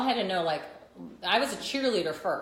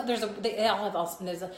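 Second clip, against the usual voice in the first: ordinary talk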